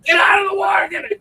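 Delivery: high, frantic lifeguard yell; lifeguard shouting warnings. Retold or imitated. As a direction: shout the order, going crazy. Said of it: "they're like".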